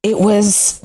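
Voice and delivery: ordinary delivery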